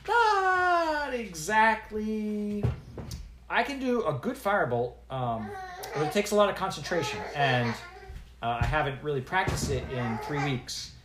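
put-on a nervous girl explaining herself; a hint of a timid young wizard girl. Voices young apprentice Zinnia.

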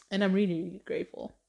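ordinary delivery

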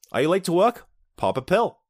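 mock retro commercial announcer; ad pitchman. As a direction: sell the pill like a TV ad. Parodies pill advertisement pitch.

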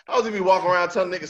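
ordinary delivery

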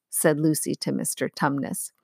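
ordinary delivery, nothing marked

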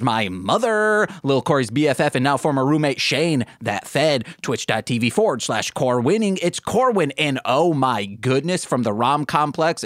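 ordinary delivery

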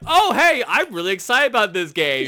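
high, loud giddy gushing; excited. Retold or imitated. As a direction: gush like a thrilled fan. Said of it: "I'm just like".

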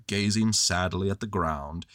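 ordinary delivery

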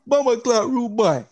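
loud, high Jamaican patois exclamation; playful Jamaican shout; mock Jamaican. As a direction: ham up a Jamaican accent. Hammed-up Jamaican slang as a joke.

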